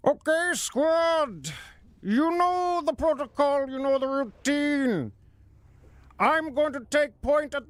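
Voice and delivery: high, slow, commanding masked voice. Acted. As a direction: speak through the mask, high and intimidating. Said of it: "the mask voice".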